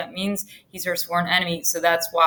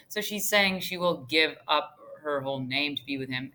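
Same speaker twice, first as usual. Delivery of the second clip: usual speaking voice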